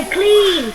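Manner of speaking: "clean" with a long, held ee; long vowel. Shown as a demonstration. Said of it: "Clean là y dài".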